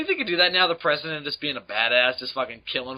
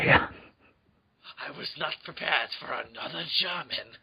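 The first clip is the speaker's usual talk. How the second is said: put-on slow, soft menacing German accent; drawn-out villain threat in German accent; cool foreign spy menace; 5 dB quieter, slower than usual. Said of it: "that Nazi from Indiana Jones".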